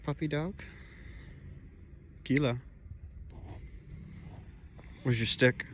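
normal talking voice

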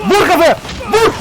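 loud, high battle cry; frantic shout. Real, not acted. Player fighting off the monster, frantic shout. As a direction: yell the order in panic.

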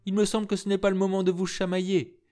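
put-on a jovial man calming the young pair; good-natured older man. A narrator's reading. Siachi soothes the squabbling pair, good-natured.

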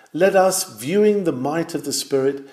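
normal talking voice

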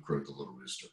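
plain reading voice

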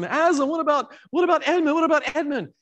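put-on high, anxious child's plea; begging like a worried little girl; pleading Lucy.

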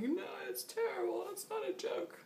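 high-pitched mock distress; imitated upset friend. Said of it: "until he started to really panic".

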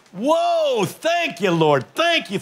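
high cries of thank you Lord; joyful praise leaping up high; grateful grandparents. Retold or imitated. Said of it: "grandmothers and grandfathers go".

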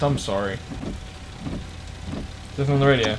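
usual speaking voice

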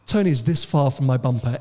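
ordinary delivery

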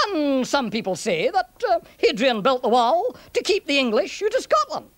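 lilting Scots storyteller; sing-song Scots burr; Scottish centurion, explaining. Acted. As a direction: weigh up both opinions in a Scottish accent.

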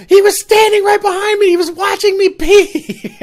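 high, shouted imitation of panic; hysterical shriek. Frightened friend telling them in the car, hysterical. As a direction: shriek it, stretching out the end.

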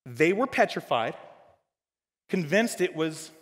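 ordinary talk, nothing marked

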